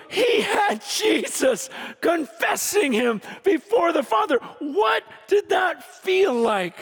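high, impassioned preaching wonder; awed exclamation. Retold or imitated. Preacher marvels before grieving family.

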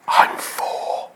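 put-on small, breathy child whispering; child's whisper. Small boy whispers to salesman on phone. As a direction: whisper it like a scared little boy.

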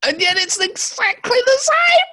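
very high, loud mock squeal; exasperated squeal. Mocking the New Zealanders' claim.

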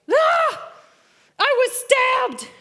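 high, loud, drawn-out wail; anguished lament. Acted. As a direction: cry out as if stabbed. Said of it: "that's lament".

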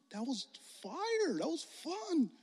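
thrilled after seeing fruit; hyped. Retold or imitated. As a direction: savour it with glee. Hyped after obeying God.

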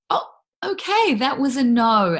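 high, loud dawning "oh"; sudden realization. Retold or imitated. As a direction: blurt it out as it suddenly hits you. Sudden realization voiced in retold moment.

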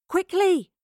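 loud, high urgent call. A narrator's reading. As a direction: call out as loud as you dare. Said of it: "called as loud as she dared".

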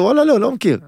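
exaggerated sheepish admission. Retelling to the agent, a high, loud mock-innocent no. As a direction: ham up the confession of knowing nobody. Repeats the admission, even higher.

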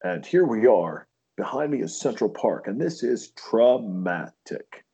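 mock live TV reporter delivery; special newscaster voice. Comedian mocking fake news live reports, put-on newscaster voice. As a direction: put on a reporter's voice, drawing out the last word. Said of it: "you've got the special voice".

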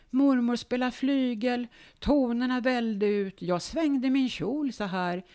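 lightly voiced young girl remembering; mother as a girl of sixteen. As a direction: let the old mother sound sixteen again, swaying. Mother reliving youth, smiling.

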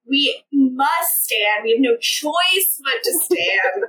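usual speaking voice

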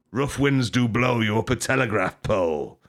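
ordinary talk, nothing marked

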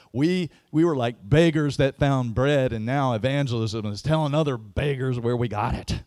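loud, forceful sermon delivery; preaching. Acted. Preacher exhorting the congregation, loud and forceful. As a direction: drive the point home to the congregation.

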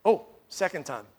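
ordinary talk

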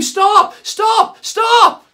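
high, loud alarmed scream to halt someone; screaming stop. Retold or imitated. Pastor acting out warning a friend of a shark, desperate scream. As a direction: scream it to stop them jumping. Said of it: "we would scream".

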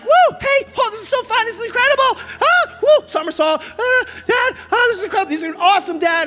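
very high, loud imitated squealing glee; thrilled kids. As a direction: whoop like children at play. Acts children having fun at gymnastics.

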